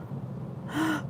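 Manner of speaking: gasp. Real, not acted. Reacting to a food in chat.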